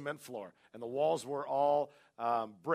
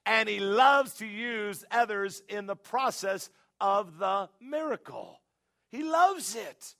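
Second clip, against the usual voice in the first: loud, high, drawn-out preacher delivery; full-force preaching; 8 semitones higher, 10 dB louder, slower than usual. Acted. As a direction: preach it out, stretching the key words.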